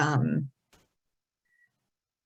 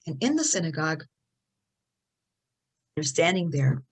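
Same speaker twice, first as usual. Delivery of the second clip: ordinary delivery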